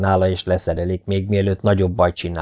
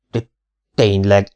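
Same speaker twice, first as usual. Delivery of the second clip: stuttering disbelief; stammering; 3 dB louder than usual. A narrator's reading. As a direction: trip over the first sound. (hebegve, hitetlenkedve)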